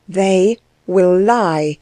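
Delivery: slow, cold, deliberate verdict; cold repetition. A narrator's reading. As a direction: spell it out coldly and very slowly. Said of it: "said Anna again".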